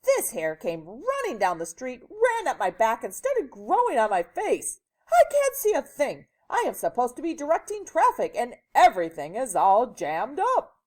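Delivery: loud, high frantic rant; exasperated policeman. A narrator's reading. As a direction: complain like a storybook cop at wit's end.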